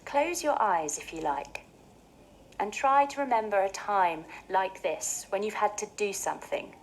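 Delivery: normal talking voice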